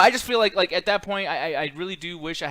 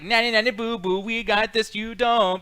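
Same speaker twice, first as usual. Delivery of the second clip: mock playground sing-song, pitched up; higher, teasing singsong mockery; childish taunt; 4 semitones higher, 3 dB louder than usual. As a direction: imitate a kid gloating in nanny-nanny rhythm. Mocks Canadians with a childish taunt.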